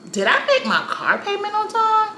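high-pitched, hesitant self-question; sheepish worried realization. Retold or imitated. Woman wondering about her car payment, sheepish worry. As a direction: let doubt creep in comically.

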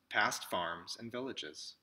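usual speaking voice

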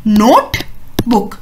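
नोटबुक said very slowly, each syllable clear; slow model word. Shown as a demonstration. Teacher models नोटबुक slowly for young pupils. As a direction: stretch नोटबुक out slowly for children. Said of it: "तो उसको हम बोलते हैं".

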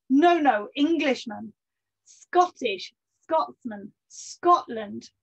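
lightly put-on Scottish accent, cheerfully correcting; a hint of a good-natured Scots sailor accent. Sailor corrects the Poles about his nationality, light Scottish accent. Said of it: "I am doing the accent".

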